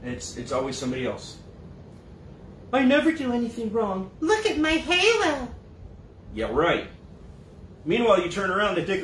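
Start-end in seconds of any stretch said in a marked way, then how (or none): 2.7-5.5 high, sugary saint voice, slowing on the halo; mock innocence. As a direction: play the blameless angel, hammed-up. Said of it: "They claim innocence".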